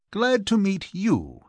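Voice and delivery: high, drawn-out greeting falling at the end; handsome, gracious reply. A narrator's reading. Archie handsomely returns Mister Connolly's greeting, gracious and expansive. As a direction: return the greeting grandly, slow and high-pitched. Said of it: "said Archie handsomely".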